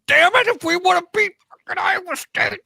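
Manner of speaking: very high-pitched, exaggerated fan lament; mock exasperated outburst. Acting out Hawkeye fans at Orange Bowl, exasperated.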